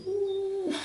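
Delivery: very high, thin "ooh"; a soft, high whistle-like ooh; wincing "ooh". Retold or imitated. Vlogger showing how a big purchase feels, wincing high ooh. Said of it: "it's just a bit like".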